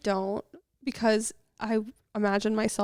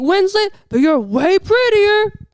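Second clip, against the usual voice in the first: high, much louder mock yell; shouted compliment; 11 semitones higher, 13 dB louder, slower than usual. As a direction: holler it across at someone, drawn out. Retelling a stranger's shouted compliment.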